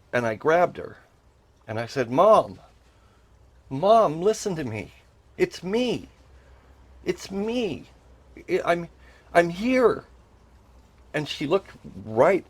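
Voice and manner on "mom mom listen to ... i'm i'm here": urgent call to get her attention, pitched up; urgent plea. Retold or imitated. Son calling to his mom in a dream, urgent plea. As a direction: plead to be heard, louder on the first call.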